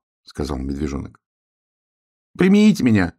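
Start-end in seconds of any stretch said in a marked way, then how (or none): 2.4-3.1 high, loud pleading whine; whining. A narrator's reading. Wet hare begging to be let in, whining. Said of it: "заскулил заяц".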